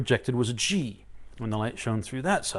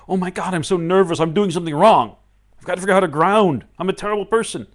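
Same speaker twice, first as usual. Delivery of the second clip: loud, raised-pitch acted fretting; nervous self-blame; 6 semitones higher, 10 dB louder, faster than usual. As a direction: fret out loud, mock panicking at yourself. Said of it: "instead of saying".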